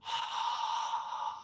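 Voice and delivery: long unvoiced sighing exhale; audible ha breath. Shown as a demonstration. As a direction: breathe out as if fogging eyeglasses. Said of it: "creating a sound on the exhale".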